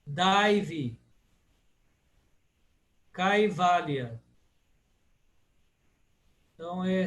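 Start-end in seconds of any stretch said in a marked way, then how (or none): 0.1-1.0 Daivi with AI as in 'pai'; ai as in pai. Shown as a demonstration. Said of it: "como em pai". 3.1-4.2 Kaivalya with AI as in 'pai'; slow 'kye-VAL-ya' for Kaivalya; ai as in pai. Shown as a demonstration. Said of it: "como em pai".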